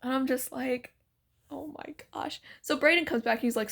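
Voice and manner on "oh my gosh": very soft, under-the-breath reaction; quietly stunned; hushed disbelief. Retold or imitated. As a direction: retell it as a private, embarrassed aside. Her mortified aside after stranger's gushing.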